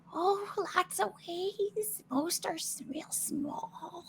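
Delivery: very high, slow whispery squeak; tiny rat, airy and drawn out; little rat voice. Acted. Game master's rats describe the exits, little squeaky voice. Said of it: "they kind of go".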